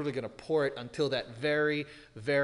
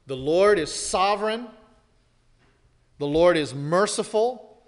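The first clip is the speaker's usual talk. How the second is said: higher, louder ringing proclamation; full-force preaching; 4 semitones higher, 8 dB louder than usual. Acted. Preacher proclaiming God's attributes to the congregation, ringing and loud. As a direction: hammer each line home from the pulpit.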